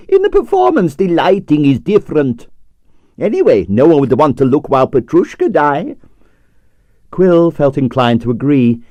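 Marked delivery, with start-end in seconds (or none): 0.1-6.0 put-on Russian accent. Stroganoff's accented voice.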